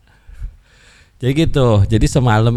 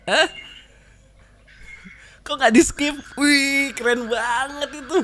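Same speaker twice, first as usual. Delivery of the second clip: much higher amazed exclaim; surprised; 12 semitones higher than usual. Real, not acted. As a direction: react in disbelief. Surprised the noise persists. (heran dan kagum)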